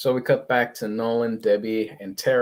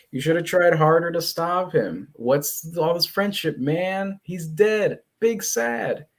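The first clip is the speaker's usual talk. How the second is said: higher-pitched mock grievance; acted reproach; 6 semitones higher than usual. Mark reproaching Alan over his brother's death, acted upset. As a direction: plead and blame in a raised voice.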